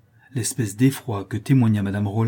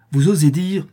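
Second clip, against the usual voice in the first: higher, louder outraged challenge; enraged; 6 semitones higher, 6 dB louder than usual. A narrator's reading. Stepmother outraged at the daughter, enraged. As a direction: hurl it at her. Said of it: "presque insensée de colère".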